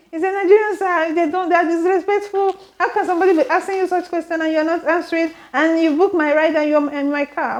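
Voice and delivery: high, fast, indignant retelling of a rant; imitating angry driver. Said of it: "This one got angry".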